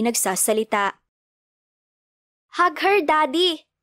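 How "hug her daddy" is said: put-on higher, slow, insistent little kid voice; child ordering. A narrator's reading. Child telling father to hug mother. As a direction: command it like a bossy small child. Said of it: "Utos ni Mati".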